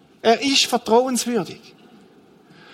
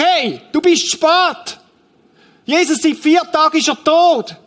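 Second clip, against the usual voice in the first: higher-pitched, heated scolding; reproachful Martha; 5 semitones higher than usual. Acted. Martha scolding Jesus for arriving four days late, angry. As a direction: lay into him for turning up late, indignant and insistent. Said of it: "Martha wetzt ihre Messer und sagt".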